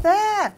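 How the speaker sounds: short high mock scream; imitated scream. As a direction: cry out like a screaming woman. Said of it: "screaming her head off".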